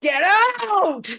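high, loud bellow of a raging monster; the Beast. Retold or imitated. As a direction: roar them out of the room. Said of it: "like, the Beast".

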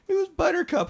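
squeaky high mock sobbing cop; crying cop voice. Cop weeping over the dead horse, squeaky high. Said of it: "He's like".

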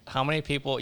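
usual speaking voice

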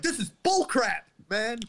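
high-pitched mock protest; hammed-up indignant complaint; mock outrage. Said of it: "people would be like".

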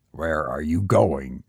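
low, mildly strange question; a man's low, slightly breathless question. A narrator's reading. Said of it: "asked Henry in a strange, breathless voice".

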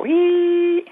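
"Wee!" as a very high, loud whistling cry; imitated eerie whistle. Describing a strange recording to hosts, imitating a high whistle. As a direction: copy the ghostly whistle on the tape. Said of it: "like a, a whistle almost come over".

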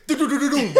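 loud, high rapid-fire "do-do-do" thudding; car-crash noise, high and loud; imitated crash rumble. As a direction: mimic a car clattering over a bump. Imitates the car hitting the rotary.

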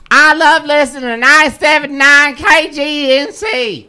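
high, loud fan holler; shouted slogan. Retold or imitated. Caller repeating the station line to win tickets, shouting it. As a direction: belt it out for the whole bar. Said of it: "shout this out right now".

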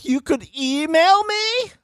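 high, slow incredulous mockery. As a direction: repeat her words in disbelief, way up high. Mocking her suggestion.

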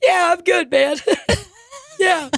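high, squeaky fake sobbing; mock crying. Fallen comedian thanking strangers who stopped, mock tearful. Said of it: "And I'm crying".

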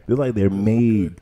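ordinary talk

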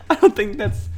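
words through leftover laughter; laughing. Real, not acted. Still laughing.

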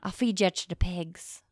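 a hint of an Irish brogue on the threat; Irish accent. Mobster threatening in a faint Irish accent. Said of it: "I was Irish, kinda".

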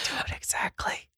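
breathy whispered command. Retold or imitated. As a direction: whisper it close to the mic. Joins the whispered director quote.

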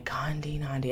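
unvoiced whisper, working the name out; whispered thinking. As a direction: whisper it to yourself while thinking. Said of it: "in their mind's eye".

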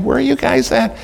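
high, fed-up question; exasperated high pitch. Retold or imitated. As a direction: voice stays high and frustrated. Asking staff again, fed up.

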